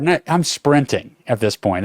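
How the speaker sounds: plain reading voice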